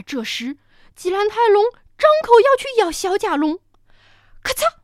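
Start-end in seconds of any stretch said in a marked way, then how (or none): none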